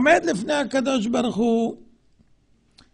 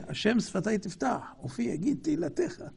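softer, muttered mock prayer; a phone addict's hollow prayer; mock meek prayer; 2 semitones lower, 9 dB quieter than usual. Preacher mocks a man who ends his call to pray, muttering. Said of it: "ואומר".

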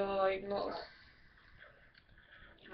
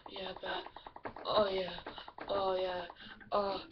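mock moaning mum; 5 dB louder, much slower than usual. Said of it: "your mum just in the bedroom going".

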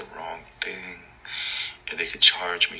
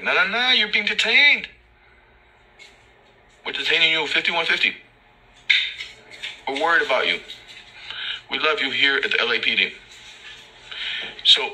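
higher, sing-song fake-caring cop; mocking cop voice; 6 semitones higher than usual. Mocking the officer who detains him, higher sing-song cop voice. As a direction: parody the officer's sweet concern.

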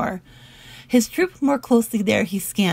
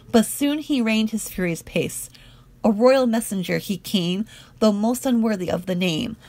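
plain reading voice

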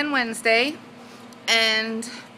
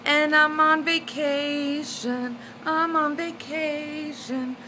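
slow, sung-out vacation; lilting vacation brag; singsong excitement; 5 semitones higher, slower than usual. Real, not acted. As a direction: drawl it like a happy chant. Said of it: "I'm excited".

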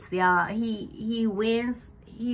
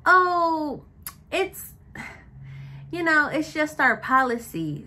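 high, sugary fake-polite voice, louder; mock a manager's sickly sweet smile; passive-aggressive sweetness; 7 semitones higher, 3 dB louder than usual. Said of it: "that passive aggressiveness".

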